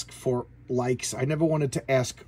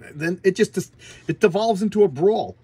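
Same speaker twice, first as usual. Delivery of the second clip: worked up, higher and louder; excited; 6 semitones higher, 8 dB louder than usual. Real, not acted. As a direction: get carried away recounting the fight. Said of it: "I'm all excited".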